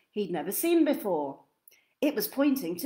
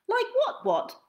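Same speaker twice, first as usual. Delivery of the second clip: high twin voice, echoing; innocent twins; 13 semitones higher than usual. Acted. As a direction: innocently echo the question. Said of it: "they said".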